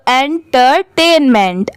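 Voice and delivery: syllable by syllable. Shown as a demonstration. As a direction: break "entertainment" into its parts, with small gaps. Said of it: "सब साथ में मिला देंगे".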